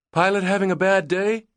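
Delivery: high, loud call over engine noise; yelled question. A narrator's reading. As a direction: shout it over the roar. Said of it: "Hallie yelled at the loadmaster".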